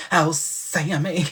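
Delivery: slow drawn-out campy Oh Sammy; Paul Lynde impression. Storyteller mimics Uncle Arthur calling Sammy, slow and campy. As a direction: stretch it like a campy sitcom uncle. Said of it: "making this child sound like".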